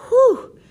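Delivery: high loud exaggerated whew; loud relieved whew. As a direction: blow out a big mock sigh of relief. Relief at near winter break.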